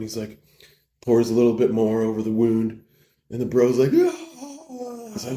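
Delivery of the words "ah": very high sustained wail; long, squealed pained cry; mock scream of pain. Whiskey hits bro's wound, a mock pained scream.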